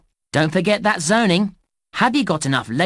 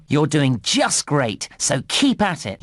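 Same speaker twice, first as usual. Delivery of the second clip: ordinary talk, nothing marked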